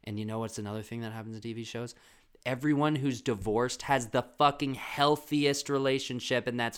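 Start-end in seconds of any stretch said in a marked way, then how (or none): 2.4-6.8 loud, slow, higher emphatic ranting; exasperated rant. Real, not acted. Comic fed up with TV divorces, loud rant.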